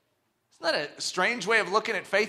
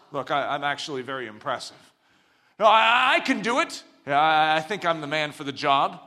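self-promoting man; 5 semitones lower than usual. Retold or imitated. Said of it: "trumpeting ourselves".

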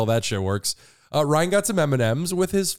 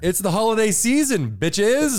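excited announcement; 7 semitones higher than usual. Acted.

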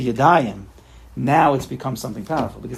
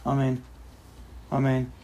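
droopy amen; 3 semitones lower, 10 dB quieter than usual. Shown as a demonstration. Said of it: "how do you say amen?".

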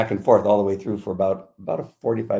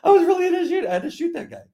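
very high, squeaky-pitched insistence; mock protest. Officer insisting the shooting was real, mock protest. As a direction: plead your case in a comic high voice.

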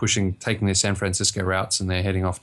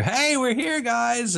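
much higher hammed-up hello to the crowd; excited call-out; much slower than usual. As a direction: play-act calling out to everyone, pitch way up. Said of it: "and go".

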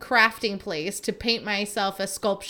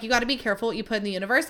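rattled off very fast in mock; mocking the advice people always give, very fast; much faster than usual. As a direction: rush through it like a tired cliché. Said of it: "The way people are like".

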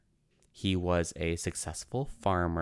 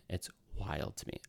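whispered aside; 10 dB quieter than usual. Real, not acted. Muttered aside to self.

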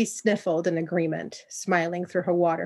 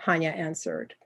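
ordinary delivery

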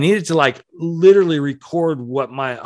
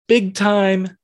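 emphatic high; 9 semitones higher, 3 dB louder than usual. Acted. Repeating phrase in stepping pitch.

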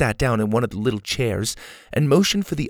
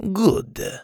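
lightly Spanish clipped approval; Spanish accent; 9 semitones higher than usual. A narrator's reading. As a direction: swordsman satisfied. Inigo agrees.

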